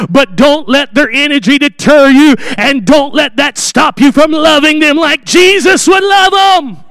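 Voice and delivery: loud, high preaching shout; fervent exhortation. Real, not acted. Preacher urging workers to love unruly kids, loud. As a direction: drive it home to the congregation.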